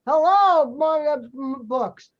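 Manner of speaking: big, projected phone-answering hello; loud demo greeting. Shown as a demonstration. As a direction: call it out like answering the shop phone. Director models phone answering.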